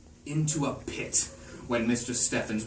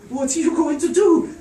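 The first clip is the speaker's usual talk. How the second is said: panicked shout; 11 dB louder than usual. Acted. Garrett to Stendhal, a panicked shout. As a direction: shout it in disbelief.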